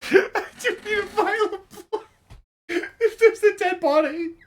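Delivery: high squeaky laughing mimicry of the player; mocking laugh. GM mocking player's line in a laughing squeal.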